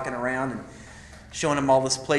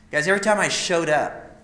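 normal talking voice

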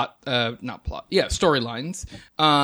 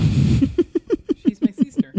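high, slow mock sincerity; mock sincere; 11 semitones higher, 7 dB louder, much slower than usual. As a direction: draw it out in a fake sweet voice. Joke line, high and slow.

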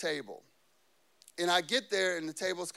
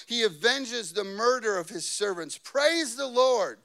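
raised, ringing preacher's reading; forceful scripture reading; 4 semitones higher, 6 dB louder than usual. Real, not acted. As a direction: proclaim the verse with emphasis.

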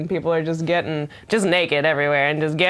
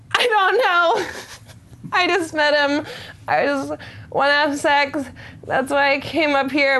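very high, hammed-up helpless pleading; flustered mock protest; 10 semitones higher than usual. She recalls border interrogation, high flustered mock protest. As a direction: protest in a high, panicky imitation. Retold answers under border questioning.

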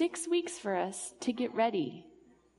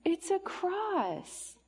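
slow, high, awed reveal; 6 semitones higher, much slower than usual. Acted.

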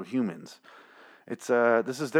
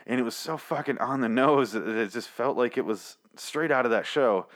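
normal talking voice

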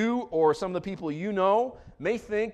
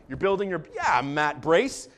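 ordinary talk

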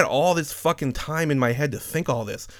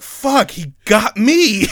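excited; 10 semitones higher, 9 dB louder, much slower than usual. Retold or imitated. Caught out by coworker's joke.

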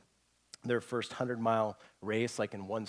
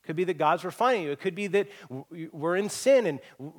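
usual speaking voice

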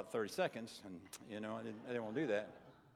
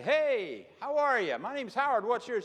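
friendly neighbor greeting; 7 semitones higher, 6 dB louder than usual. Retold or imitated. Preacher acts out greeting neighbor.

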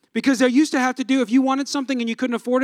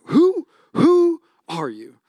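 slow, drawn-out, higher "who, who are you?"; mock bewildered; 5 semitones higher, 4 dB louder, much slower than usual. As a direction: stretch it out like you can't believe who this is. Mock stunned parent at sleepy kid.

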